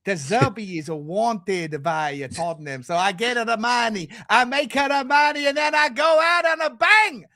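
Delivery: put-on comic Italian accent building to a shout; exaggerated Italian-English, big finish on the punchline. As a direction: rant as a hammed-up Italian manager. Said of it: "doing an Italian accent again".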